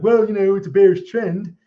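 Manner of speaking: loud, slack-jawed dopey voice. Retold or imitated. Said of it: "my dopey voice".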